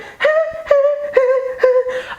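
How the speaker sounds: falsetto disco vocalising; imitated high backing vocal; high sung "ha" notes. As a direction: sing a string of very high, spaced "ah" notes. Carries on singing the song hook.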